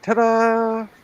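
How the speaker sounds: loud, drawn-out little fanfare; showy reveal. Real, not acted. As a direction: present it like a magician's reveal. Announcing finished memory swap.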